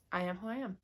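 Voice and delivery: ordinary talk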